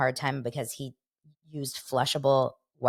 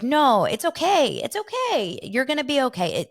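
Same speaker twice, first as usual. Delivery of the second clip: mock soothing; 9 semitones higher, 4 dB louder than usual. Host retelling how the co-host soothed his crying partner, high and soothing. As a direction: comfort her like a worried father.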